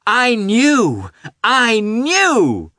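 put-on high, loud child's cry; exultant cry. A narrator's reading. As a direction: cry it out triumphantly as a little boy. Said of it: "he cried".

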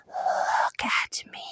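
slow hollow whisper with a moan; slow creature whisper. Acted. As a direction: drag out the ghostly call. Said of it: "hollow voice whispered".

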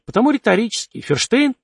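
high teasing rise on Ферштейн; teasing question. Acted. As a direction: tease him with Ферштейн, pitched high.

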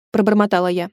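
ordinary delivery

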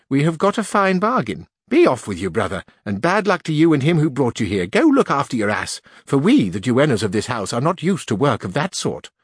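put-on scornful old duenna. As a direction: send the fool packing. Duenna scolding.

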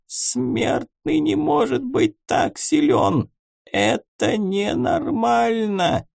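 high, slow hissing creature voice; hissing monster book. A narrator's reading. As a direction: hiss it out like a dying beast. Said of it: "голосом зверокниги слабо прошипела".

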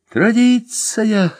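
high, slow musing; pensive. Acted. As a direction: let the word hang thoughtfully. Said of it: "задумчиво сказал". (задумчиво, медленно и высоко)

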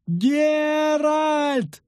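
high drawn-out squealing cry; screech. A narrator's reading. Lyutik calls to Geralt, screeching. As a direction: screech the name out long and high. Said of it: "верещал Лютик".

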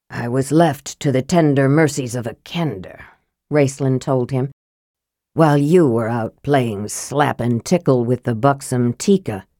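low, dry, reproachful mage; sour Raistlin. Acted.

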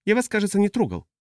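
high-pitched, wary protest; timid customs officer. Acted. Customs officer answers huge naked stranger, high and timid. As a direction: protest nervously, pitched well up.

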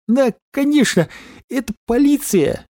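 high, relieved glad cry; overjoyed. A narrator's reading. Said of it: "обрадовался Дикобраз".

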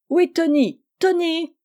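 high, loud call for someone. A narrator's reading. Family waiting to leave calls for Tony, calling out. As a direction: call the name out, pitch climbing.

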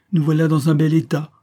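high, loud exclamation of dismay; exclaimed. A narrator's reading. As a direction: cry out in vexed complaint. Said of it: "s'écria Céline en secouant ses jupes".

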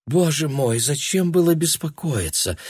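a hint of a fussy elderly lady; lightly voiced old woman.

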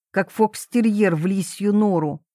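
plain reading voice

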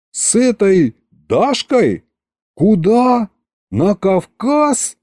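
high, drawn-out shocked questions; incredulous. A narrator's reading. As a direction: question each piece in rising disbelief. Shocked questions about the elopement.

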